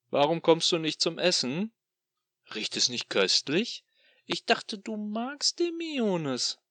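loud, sneering demon voice; gloating tormentor taunting his captive; mocking demon. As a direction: toy with him like a cruel god. Demon lures with food.

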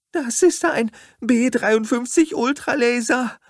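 very high, loud screech; shrieking high and loud. A narrator's reading. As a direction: screech it in alarm like hysterical women. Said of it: "kreischten die Weiber".

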